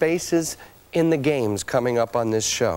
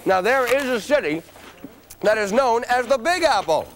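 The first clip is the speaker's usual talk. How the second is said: high, upbeat game show host; booming prize announcer; 7 semitones higher than usual. Acted. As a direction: pump up the studio audience. Host runs quiz round.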